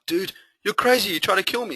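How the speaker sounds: put-on rattled young guy accusing the guru; shocked accusation. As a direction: cry out that he tried to kill you. Johnny after being dunked.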